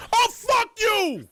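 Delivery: slow furious outburst at the opponent; furious yell. Real, not acted. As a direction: yell it at the screen in real rage. Raging at opponent's move.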